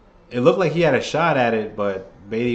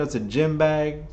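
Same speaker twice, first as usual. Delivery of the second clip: ordinary delivery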